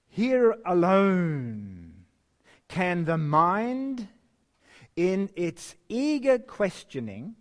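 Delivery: solemn pulpit reading of a devotional text; reverent reading. Acted. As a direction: read it out with awe, drawing out each phrase. Reading the Education quote.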